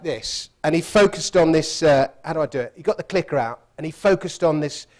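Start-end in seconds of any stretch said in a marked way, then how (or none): none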